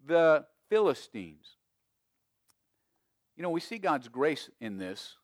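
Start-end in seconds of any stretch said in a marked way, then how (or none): 0.0-1.5 raised, higher preaching voice; preaching at full force. Real, not acted. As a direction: preach it loud and insistent.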